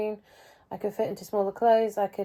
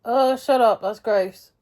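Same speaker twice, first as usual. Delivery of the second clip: grossed out; 3 semitones higher, 8 dB louder, slower than usual. Real, not acted. Grossed out, laughing at herself.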